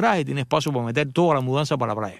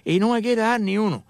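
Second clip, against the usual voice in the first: a higher, pleading voice as the wife; wife complaining; 7 semitones higher than usual. Retold or imitated. Wife to husband about the parked cars, mock complaint. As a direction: play the wife who wants the car. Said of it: "Cuando tu mujer te dice".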